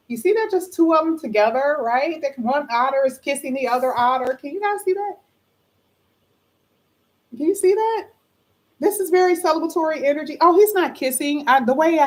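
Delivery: high, delighted gushing over the card; excited gushing. Real, not acted. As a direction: point it out with real delight, pitch way up. Excited about otters on card.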